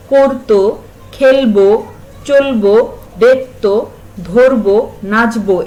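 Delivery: each verb such as খেলবো ends on a clearly sounded o; final o sounded. Shown as a demonstration. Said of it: "প্রত্যেকটিতেই কিন্তু আমি ব এর সাথে একটা ওকার উচ্চারণ করছি".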